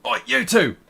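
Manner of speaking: loud, high barked order across a hall; calling guards across a hall, loud shout. Acted. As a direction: bellow for the guards. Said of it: "he shouts, and you hear him shout across the hall".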